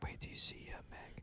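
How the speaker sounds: hushed whisper right in your ear. Acted. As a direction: breathe it softly like a secret. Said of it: "that Andrew Yang whisper song".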